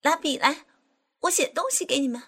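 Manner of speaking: high, loud and fast; shouting. Acted. Furious woman shouting for a pen to sign. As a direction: bark for a pen in a rage. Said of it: "气得发昏的婷大喊大叫".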